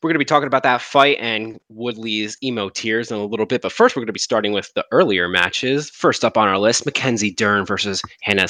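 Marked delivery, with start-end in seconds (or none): none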